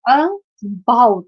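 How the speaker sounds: "about" slowly in two parts, a-BOUT; split by syllable. Shown as a demonstration. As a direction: draw "about" out, pausing before "bout". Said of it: "according to the sound".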